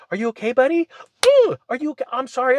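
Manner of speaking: mock sympathy. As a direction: coo like a sorry bully. China fake-comforting its victim.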